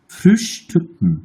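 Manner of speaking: Frühstücken drawn out, ü and final -ken clearly spoken; slow German demo. Shown as a demonstration. Teacher showing the correct German sound to students, slow. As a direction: model Frühstücken very slowly. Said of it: "এটা উচ্চারণ করছে না".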